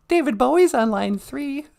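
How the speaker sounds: very high, small comic voice; high-voiced sidekick. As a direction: pipe up as the host's curious sidekick. Sidekick figure beside host.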